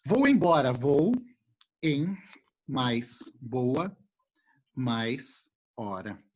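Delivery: "Vou embora" slowly pulled apart into "vou em boa hora"; word broken apart. Shown as a demonstration. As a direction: break "embora" into its parts one by one. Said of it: "Olha só".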